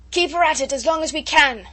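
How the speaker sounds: high, loud shouted order. A narrator's reading. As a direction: bellow the order through the gale. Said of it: "shouted Captain MacWhirr".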